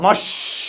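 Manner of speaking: मस्ट with a long drawn "sh" hiss before a soft final t; मस्ट, stretched unvoiced middle; shown pronunciation. Shown as a demonstration. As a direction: say मस्ट with the sibilant held out. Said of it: "ऐसे पढ़ना".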